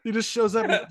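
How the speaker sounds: ordinary talk, nothing marked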